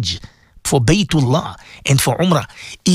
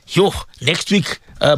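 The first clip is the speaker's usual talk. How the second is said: ordinary talk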